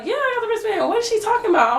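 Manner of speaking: high, puzzled mom voice; lightly voiced mom. Mom on the phone asking what's wrong, high and puzzled. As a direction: sound like a confused mom.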